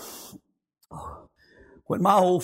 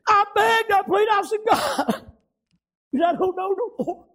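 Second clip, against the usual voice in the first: high, choked-up voice breaking between phrases; tearful testimony; 12 semitones higher than usual. Real, not acted. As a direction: plead it through tears, halting with long pauses. Choked up recalling desperate prayer.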